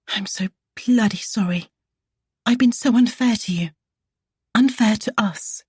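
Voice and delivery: shaky, hushed then rising apology; trembling voice. A narrator's reading. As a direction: let the voice tremble as she apologises. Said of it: "in a trembling voice".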